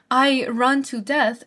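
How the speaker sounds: slow poem reading. Shown as a demonstration. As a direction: read it like a verdict. Quotes the line slowly.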